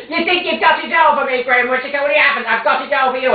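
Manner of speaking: high, loud shouting at the door. Acted. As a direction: yell through the bedroom door. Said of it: "comes shouting outside my bedroom door".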